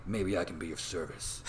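low, understated hero voice; Michael Keaton. Retold or imitated. Keaton stepping from the car to the mourners, low and cool. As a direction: drop low for a cool, composed entrance. Voicing Keaton's arriving Bruce Wayne.

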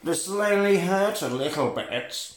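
slow, drawn-out impression of a film thug. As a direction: stretch it out in a mock villain voice. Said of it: "but he talks like that".

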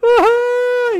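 high mock wail; imitated child's crying. Son scared by mom's threat, high mock bawling. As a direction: wail like a little kid, hammed up. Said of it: "he starts crying".

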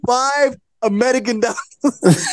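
slow, drawn-out lowball offer; slow punchline. Retold or imitated. Prince to the narrator, a slow deadpan offer. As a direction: let each word hang, deadpan.

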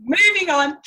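loud, high, through real laughter; laughing it off. Real, not acted. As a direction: brush it off while laughing. Laughing off her bad impression.

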